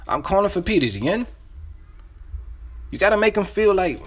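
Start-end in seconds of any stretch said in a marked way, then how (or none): 0.1-1.3 higher-pitched, insistent familiar caller; pressing, as if already known; urgent insider. Shown as a demonstration. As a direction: act like they forgot you, with urgency. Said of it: "a little urgent".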